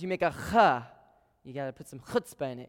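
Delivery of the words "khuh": "huh" with a hard throat-scraped ch; loud, guttural "huh" from the back of the throat; throaty chet sound. Shown as a demonstration. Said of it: "you make the noise just like it sounds".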